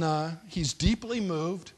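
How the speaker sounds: ordinary talk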